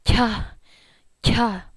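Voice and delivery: "Kya" breathed out with lots of air, worn out; tired aspirated kya. Shown as a demonstration. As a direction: puff "kya" like you're out of breath on the third flight. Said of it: "I sound like".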